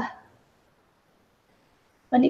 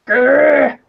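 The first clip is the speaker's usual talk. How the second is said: playful growl; 6 dB louder than usual. Pretend. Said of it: "I can say grr".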